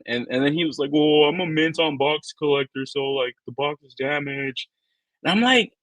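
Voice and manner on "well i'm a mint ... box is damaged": higher put-on voice of a picky buyer; mock the complaining customer in a raised pitch; imitated fussy collector.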